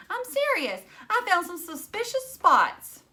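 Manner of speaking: high, loud and urgent; insistent girl. A narrator's reading. Phoebe insisting to her father about blood spots, high and urgent. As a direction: push hard to be believed.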